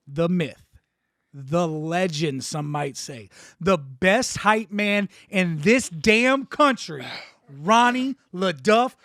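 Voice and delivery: slow, loud, drawn-out build; hype intro. Acted. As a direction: announce him like a champion.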